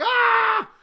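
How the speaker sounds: high thrilled yelp; watching Rey flip, a high thrilled yelp; excited yelp. Retold or imitated. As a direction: squeal at the flip. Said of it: "I'm like".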